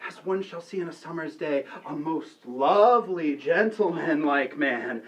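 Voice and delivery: normal talking voice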